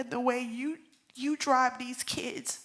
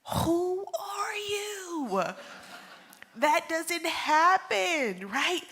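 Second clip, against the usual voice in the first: high, loud, drawn-out exclamation; astonished disbelief; 8 semitones higher, 6 dB louder, much slower than usual. Real, not acted. Widow marveling at a man's grace, incredulous. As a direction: stretch each word out in loud, high amazement. Amazed at husband's calm grace.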